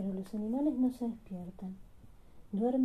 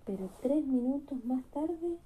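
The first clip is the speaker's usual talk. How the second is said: ordinary delivery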